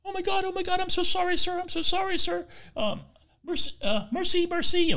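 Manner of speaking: high, frantic mock apology in a squeaky voice; panicked tourist. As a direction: play a flustered American tourist panicking. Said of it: "she panics".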